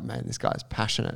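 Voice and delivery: whispered aside to himself; private whisper. Retold or imitated. Telling a friend his private thought, whispered. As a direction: breathe it as a secret thought. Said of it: "And I was like".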